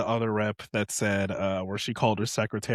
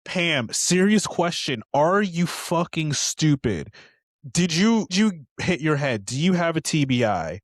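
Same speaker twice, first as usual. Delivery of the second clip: mock exasperated rant; 6 semitones higher, 5 dB louder than usual. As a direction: talk down to her, disbelieving. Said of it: "I've been like".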